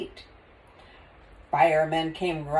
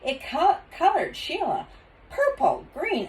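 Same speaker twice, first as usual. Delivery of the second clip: ordinary delivery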